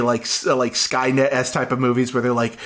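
ordinary delivery

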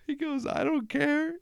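squeaky, high-pitched laughter through the words; can barely get the story out for laughing; real giggling fit while retelling the clip; laughing while talking. Real, not acted. Cracking up at the video.

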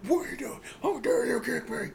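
hammed-up Roman complaining about being kicked; mock outraged Roman. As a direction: whine it like an offended boss.

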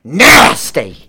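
loud, punchy comic blurt; mock shouted name. Giving a name to the caller, mock shouted blurt. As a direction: belt out the surname for laughs.

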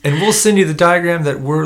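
loud, high email call-out; enthusiastic plug. Real, not acted. Co-host loudly plugging the show email, high and excited. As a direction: sell the address with big energy.

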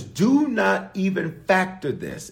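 slow, every word pressed; slow emphatic command. Acted. Preacher voicing God to the congregation, slow emphatic. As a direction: drag out the warning.

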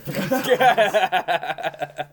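loud burst of laughter. Real, not acted. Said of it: "he punched the air".

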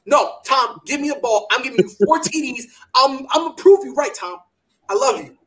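loud, pumped-up begging; eager pleading. Retold or imitated. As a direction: beg him to hand you the ball.

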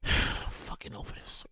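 breathy whisper, slow; whispered exhaustion. Real, not acted. Fed up with Hulu, a slow exhausted whisper. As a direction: let it out like a tired exhale.

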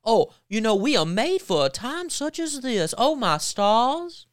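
mock Southern accent. Host mocking the politician's fake Southern accent, hammed-up Southern. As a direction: parody a put-on Southern belle, syrupy and over the top. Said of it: "So she does the fake country accent".

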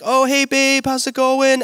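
playing himself as the breezy husband; cheery high greeting. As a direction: toss back a chirpy high hello. His cheerful reply voiced.

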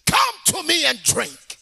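high, loud open-throated proclamation; shouting Jesus. Acted. Preacher showing what Jesus said, high full shout. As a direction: call it out over a big crowd. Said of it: "He said".

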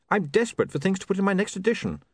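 apologetic and caught out; flustered young man. Acted. William pleads.

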